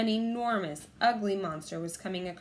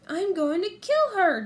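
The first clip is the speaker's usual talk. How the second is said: exasperated, slow, loud; muttered frustration; 11 semitones higher, 8 dB louder, slower than usual. A narrator's reading. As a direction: grit out your annoyance. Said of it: "Jack muttered".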